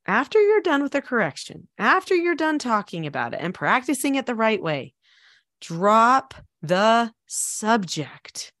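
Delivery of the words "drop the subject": each word spaced out, very slow; slow emphatic delivery. Real, not acted. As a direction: say DROP THE SUBJECT one deliberate word at a time. Spells out key advice word by word.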